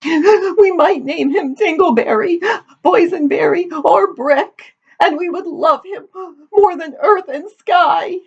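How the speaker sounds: high, hammed-up tearful wail; weeping. A narrator's reading. Daughter tells her father about the future son, weeping. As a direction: sob through it in a very high voice. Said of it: "sobbed Amity".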